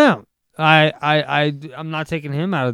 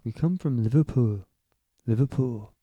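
Liverpool accent. Shown as a demonstration. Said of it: "that's how they say it".

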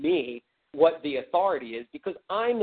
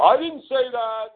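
attorney general denial; 9 semitones higher, 5 dB louder, slower than usual. Retold or imitated. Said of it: "the Attorney General".

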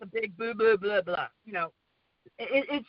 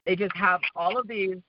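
normal talking voice

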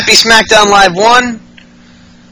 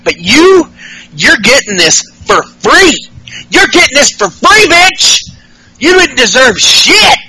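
mock taunt; 7 semitones higher than usual. [name] taunting Ellsworth.

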